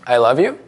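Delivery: "I love you" lifting sharply up on "you"; rising question tune. Shown as a demonstration. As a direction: let "you" swing upward so "I love you" sounds unsure. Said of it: "I'll go up first".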